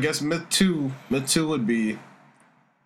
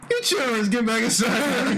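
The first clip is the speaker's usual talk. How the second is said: put-on fussy old-timer; 8 semitones higher than usual. Mocked as old, hollers at children, in a hammed-up old-timer voice. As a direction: call the kids in like an old-timer. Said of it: "That's how I know you're old".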